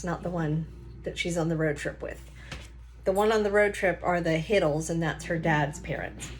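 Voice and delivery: plain reading voice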